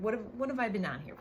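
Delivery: normal talking voice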